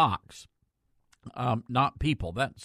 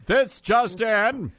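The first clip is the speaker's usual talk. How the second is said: slow, higher, louder breaking-news announcement; news anchor voice; 8 semitones higher, 6 dB louder, much slower than usual. Shown as a demonstration. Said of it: "my voice changes".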